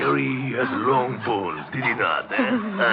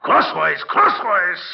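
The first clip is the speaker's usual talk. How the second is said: loud, high, impatient order; exasperated French-accented yell; angry correction; 9 semitones higher, 7 dB louder, slower than usual. Acted. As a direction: shout the instruction at a bungler. Correcting the captive's cut.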